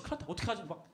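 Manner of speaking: hammed-up cry of dismay; acted panic. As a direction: blurt it like a panicking teenager. Voicing a teen in sudden trouble.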